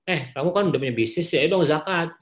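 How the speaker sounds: bossy demand. Retold or imitated. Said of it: "memberikan perintah seruan".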